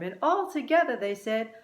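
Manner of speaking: ordinary delivery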